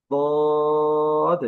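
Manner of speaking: "দদ" with a long, high, steady o; drawn-out letter name. Shown as a demonstration. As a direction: hold the vowel of "দদ", then close on d. Teacher models the letter name.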